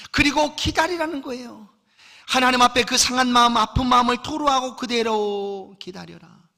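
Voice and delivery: loud, high impassioned preaching; raising the voice to press the point home; full-force preaching. Acted. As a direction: preach it out with fire.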